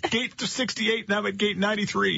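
high, surprised call across the gate; confused call-out. Retold or imitated. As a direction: call out puzzled to friends far away. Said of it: "you're like".

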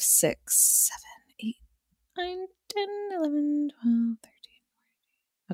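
whispering the count in disbelief; whispered counting. Real, not acted. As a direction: count under your breath. Counting long fan message.

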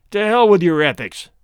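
loud, exasperated burst; angry outburst. Acted. Womble bursting out at Messner's ethics talk, angry and loud. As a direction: blow up at his smug lecture. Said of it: "the other burst out".

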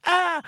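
high, sudden "ah!"; short high cry of a trapped spirit; spirit's yelp. A narrator's reading. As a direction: yelp high as you get stuck. Spirit stuck again in the story.

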